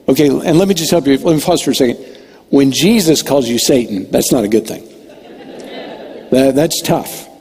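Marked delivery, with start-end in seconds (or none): none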